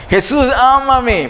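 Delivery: high, drawn-out imagined plea; mock crying out. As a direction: call out slowly as the begging blind man. Imagined cry of the blind man.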